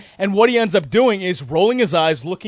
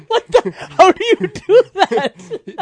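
high, slow, laughing through words; 14 semitones higher, slower than usual. Real, not acted. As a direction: laugh out the disbelief. Laughing at bike crash.

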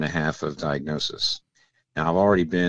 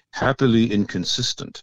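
imitating a professor's French accent, pitched higher; 4 semitones higher than usual. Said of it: "he said it in his French accent".

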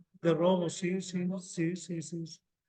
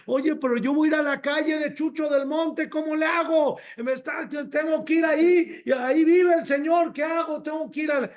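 higher, louder, panicky rush of questions; anxious pleading; 6 semitones higher, 3 dB louder than usual. Pretend. Worried person asking how to avoid saying a name, anxious and pleading. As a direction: play someone fretting about where to go.

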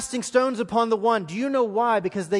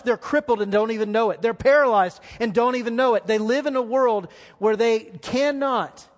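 louder, higher, pressing preaching; impassioned preaching; 4 semitones higher, 4 dB louder than usual. Acted. Pastor pleading for hurting friends, impassioned preaching. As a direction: plead with the congregation, building.

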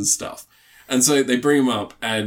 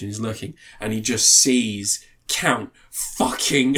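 ordinary delivery

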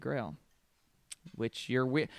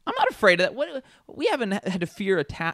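mock rant; 6 semitones higher than usual. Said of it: "you must stop this anti-Italian rant".

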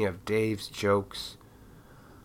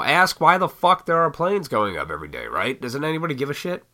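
normal talking voice

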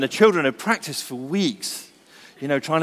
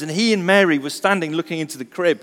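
usual speaking voice

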